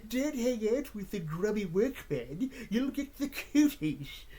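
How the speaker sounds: put-on spoiled kid voice with a laugh breaking through; little brat. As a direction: play a snooty rich brat, drawn out. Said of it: "this little brat".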